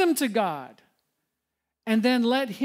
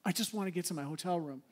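weary griping; 4 semitones lower, 10 dB quieter, faster than usual. Retold or imitated. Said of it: "you're like".

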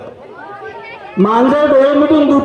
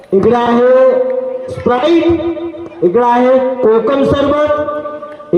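slow announcing; much slower than usual. Real, not acted. Host slowly presents drinks.

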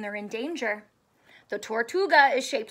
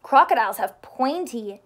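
ordinary delivery, nothing marked

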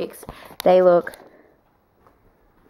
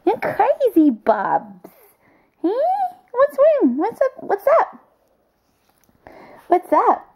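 much higher squeaky pet talk; cooing pet voice; 13 semitones higher, 4 dB louder, slower than usual. Real, not acted. Cooing at her dog Bubs, squeaky high pet voice. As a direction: coo up high at the puppy.

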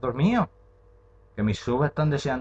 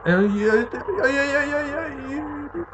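high, rapid panicked ay cries; panicked wail; much slower than usual. Real, not acted. Gamer panicking as the monster chases her, high wails.

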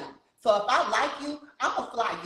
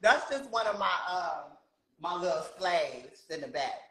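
ordinary delivery

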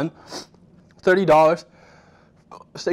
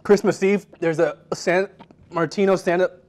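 reading through tears and laughter; strained, laughing through the pain; mouth burning from spicy food; 3 semitones higher, slower than usual. Real, not acted. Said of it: "wipe my tears away".

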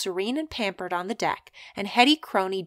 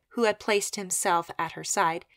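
ordinary delivery, nothing marked

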